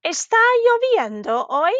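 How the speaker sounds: "Está lloviendo hoy" high on "lloviendo", swooping up on "hoy"; "Está lloviendo hoy" as an exaggerated question; rising question. Shown as a demonstration. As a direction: ask "Está lloviendo hoy" with a big final lift. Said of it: "that was another question".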